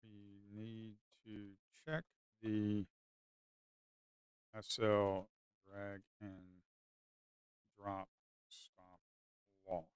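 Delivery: very soft, half-swallowed muttering. Real, not acted. Trader quietly talking through a failed stop, muttering. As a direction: mumble it to yourself, barely audible.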